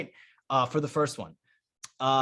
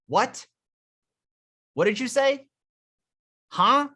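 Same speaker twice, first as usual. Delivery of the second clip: higher, louder, puzzled asking over noise; straining to hear in a loud club; girl who can't hear; 8 semitones higher, 4 dB louder than usual. Retold or imitated. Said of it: "start the interaction with".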